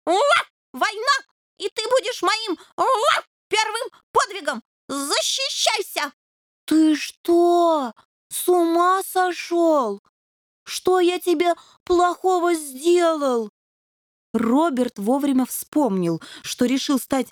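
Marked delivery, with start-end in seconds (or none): 0.1-6.1 much higher, grand puppy challenge; war cry. A narrator's reading. Puppy challenges the devil to a duel, heroic. 6.6-13.5 higher, slow, startled little devil; plead, bewildered and hurt; alarmed protest. A narrator's reading. Devil asks the puppy what he did wrong, alarmed.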